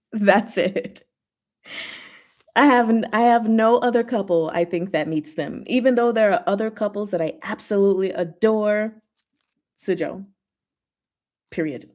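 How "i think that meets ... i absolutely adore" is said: ordinary delivery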